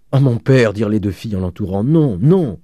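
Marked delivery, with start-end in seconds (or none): 0.1-0.7 high, loud cry of protest; alarmed cry. A narrator's reading. Two daughters surrounding their father, alarmed cry. 1.8-2.6 high, loud swooping no; alarmed refusal. A narrator's reading. Daughters refusing their father's sacrifice, alarmed cry.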